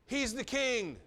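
high, loud scornful jeer; a preacher acting out the mockers' taunt; mocking. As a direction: throw it out as a taunt. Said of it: "they're like".

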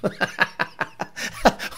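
soft breathy run of laughs; helpless chuckling; laughter. Real, not acted. Host laughing at his own cop bit, breathy laughter.

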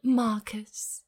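drawn-out British Marcus; British accent. Acted. Co-host mimicking the hero's name, British accent. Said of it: "don't read this in an accent".

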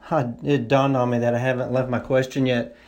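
ordinary talk

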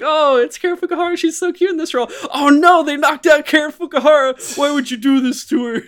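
a moviegoer fawning over Karen Fukuhara on screen; gushing fan. Retold or imitated. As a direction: squeal over a favourite actress, then mock outrage. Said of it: "you're just like".

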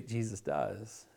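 ordinary talk, nothing marked

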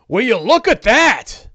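loud, high exclamation; Don John. Acted. Onlooker watching the ogre flee, loud amazed voice. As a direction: call out in amazement. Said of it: "Don John's like".

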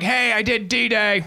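put-on high, slow kid's brag; boasting kid. Kid boasting to war-veteran grandfather, mock boastful. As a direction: put on a kid's proud call.

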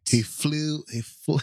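high-pitched, hammed-up Scottish attempt; Scottish accent. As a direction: struggle comically into a Scottish accent. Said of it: "than my Scottish accent".

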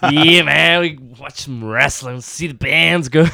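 very loud, slow hammed-up American drawl; mock American accent. Joking with a friend, booming mock American accent. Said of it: "That's it. That's it. That's the one".

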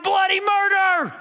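a hammed-up scream of bloody murder; mock yell. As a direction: yelp it like a startled onlooker. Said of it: "I'd be like".

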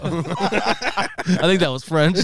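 ordinary talk, nothing marked

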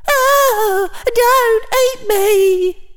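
very high, slow squealing plea; screaming food. A narrator's reading. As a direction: squeal it like a sandwich begging for its life. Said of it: "visualize it screaming".

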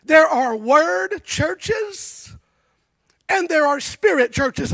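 slow, loud and deliberate quotation; weighty quote. Retold or imitated. Preacher quoting R T Kendall to the church, slow and weighty. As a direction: lay the quote down slowly, word by word. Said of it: "said this".

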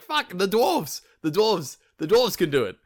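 high, loud panicked outburst; mock shriek. Loki panicking before a threatening Thor, high loud mock shriek. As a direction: blurt the dwarves like a last-ditch escape. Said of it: "Loki shrieks".

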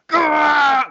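loud, high-pitched "gah!"; mock roar of outrage after a soaking; reenacted angry yell. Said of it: "I start yelling something awful".

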